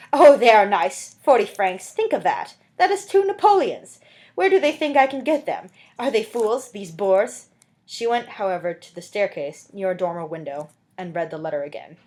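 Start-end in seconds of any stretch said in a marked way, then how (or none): 0.1-7.5 loud, high, mocking disbelief; scornful laughing outburst. A narrator's reading. Fantine scoffs at the Thénardiers' demand to her neighbor, loud and mocking. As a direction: scoff it out, half laughing at the nerve of them. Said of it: "She burst out laughing and said".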